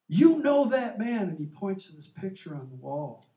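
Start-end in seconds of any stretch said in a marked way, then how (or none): 0.1-1.3 higher, louder, slow accusation; retold angry shout. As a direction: hurl it at them like the outraged father. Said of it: "he just explodes".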